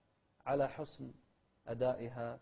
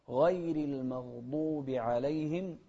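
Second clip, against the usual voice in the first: slow, loud drawn-out verse; Quran recitation; 6 dB louder, much slower than usual. Shown as a demonstration. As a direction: recite slowly at full voice. (ترتيل بطيء بصوت مرتفع)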